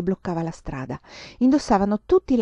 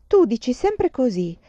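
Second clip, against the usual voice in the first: put-on higher, louder, slower child complaint; whining child; 6 semitones higher, 5 dB louder than usual. A narrator's reading. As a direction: complain like a sulky little girl. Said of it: "si lamentò la bambina". (bambina che si lamenta, più acuta)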